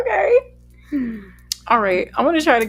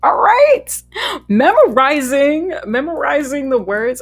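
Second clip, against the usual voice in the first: excited; 8 semitones higher, 9 dB louder, slower than usual. Real, not acted. Celebrates memorizing the lyrics.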